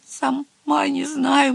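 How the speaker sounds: high, slow, timid little voice; frightened. A narrator's reading. Little witch answering the head witch, frightened. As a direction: answer meekly in a small high voice. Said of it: "тряслась от страха".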